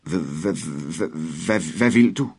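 frightened stutter, rising at the end; stammering in fear. A narrator's reading. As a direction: stammer out the question, scared. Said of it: "stammede drengen". (en dreng stammer forskrækket)

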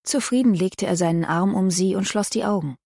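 ordinary delivery, nothing marked

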